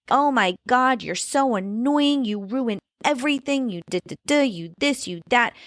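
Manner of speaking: mock whiny rant at her sister; retold sibling tantrum. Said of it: "sent the one ... into a tizzy".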